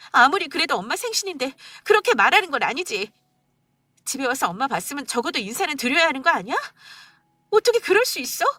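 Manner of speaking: wife tearfully confronting husband on mother's birthday. Acted. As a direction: pour out the hurt, close to crying.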